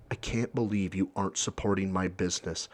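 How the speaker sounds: low, soft, deflated guilt trip; a hint of a sulking shop owner; defeated shop owner. Shop owner guilt-tripping a customer, softly deflated. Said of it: "then sighs, looks defeated".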